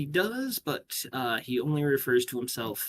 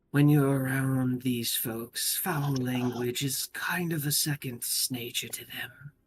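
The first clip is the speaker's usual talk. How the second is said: put-on amused young lord; 3 semitones higher than usual. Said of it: "young lord kind of chuckles and goes".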